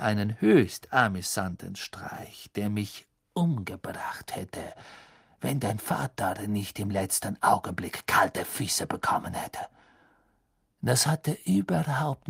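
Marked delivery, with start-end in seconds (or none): 2.5-9.7 whisper. Acted. Snape hissing a bitter accusation at Harry in a whisper. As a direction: breathe it out menacingly.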